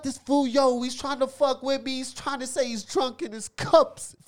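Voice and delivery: mock the wannabe gangster; fake tough gangster. Mocking the wannabe gangster kid.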